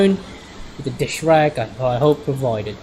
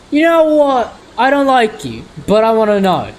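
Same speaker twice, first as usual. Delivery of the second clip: high and louder, mock exasperated; teasing aside; 7 semitones higher, 5 dB louder than usual. Real, not acted. Reader teases the character between lines. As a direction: scold the character playfully.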